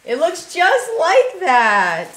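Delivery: high, loud, drawn-out delight; amazed excitement. Real, not acted. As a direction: exclaim it slowly with real amazement. Amazed at the matching pullover.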